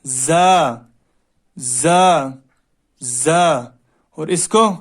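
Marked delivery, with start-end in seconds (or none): 0.1-3.6 زا pushed out firmly and hard; hard letter. Shown as a demonstration. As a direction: press زا out with force. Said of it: "شدت سے یعنی سختی سے".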